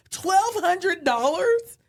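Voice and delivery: mock shocked husband. Wife imitating her husband hearing the loss, stunned. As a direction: stretch it out in disbelief.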